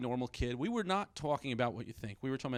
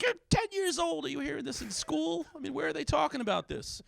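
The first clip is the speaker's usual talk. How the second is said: shocked dad; 13 semitones higher than usual. Retold or imitated. Dad questions his ten-year-old about school, acted shock. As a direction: react like an aghast father.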